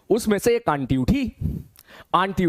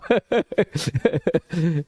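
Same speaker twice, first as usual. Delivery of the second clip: laugh. Real, not acted. Laughing after story.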